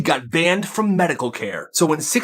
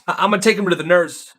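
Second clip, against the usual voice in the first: hurried coach; 5 semitones higher, faster than usual. Acted. Coach hurrying injured kid.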